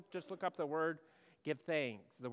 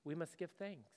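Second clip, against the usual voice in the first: ordinary delivery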